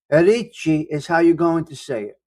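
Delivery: usual speaking voice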